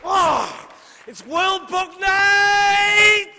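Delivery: high, loud, drawn-out cheer; excited whoop. Real, not acted. Poet greeting the World Book Night crowd, whooping. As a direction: whoop it up as you take the stage. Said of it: "So happy to be here".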